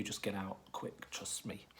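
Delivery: usual speaking voice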